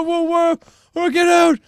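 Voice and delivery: ordinary delivery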